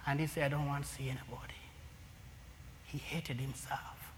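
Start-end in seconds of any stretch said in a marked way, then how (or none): 0.5-1.5 fast, whispered refusal; hushed refusal. Retold or imitated. Prisoner refuses visitor, quoted. 2.8-4.1 slow, soft whisper. Real, not acted. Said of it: "whispered aside on self-hatred".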